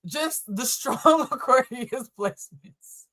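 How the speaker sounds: slow words broken by giggles; laughing. Real, not acted. Reader backpedalling to Aquarius viewers, laughing. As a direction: drag it out through laughter. Laughing while softening the reading.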